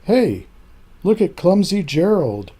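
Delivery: loud mocking sneer from the crowd. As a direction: taunt him across the dance floor. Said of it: "the animals all sneered".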